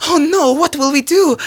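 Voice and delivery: very high, loud wail; panicked cry. Acted. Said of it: "cried Zelda".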